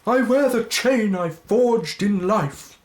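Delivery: high, loud, slow spectral voice; ghostly wail. Acted. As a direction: intone it like a phantom. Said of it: "replied the ghost".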